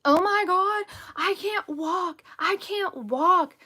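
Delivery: high, drawn-out dramatic wail; mock screaming. Stepmom imitates boy fallen off his bike, screaming. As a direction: imitate an overdramatic child wailing over a scuff. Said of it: "he started screaming and saying".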